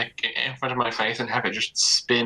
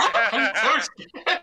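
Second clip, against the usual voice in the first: laughing; 10 semitones higher, much slower than usual. Acted. Sarcastic mock fear, laughing.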